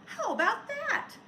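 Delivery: put-on high, delighted kids' cry; high delighted children; children's exclamation. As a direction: cheer it like a crowd of kids. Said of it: "The children all said".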